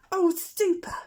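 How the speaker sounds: put-on a baby owl thrilled at permission; delighted owlet. A narrator's reading. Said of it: "said Plop".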